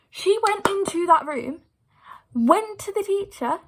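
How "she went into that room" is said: usual speaking voice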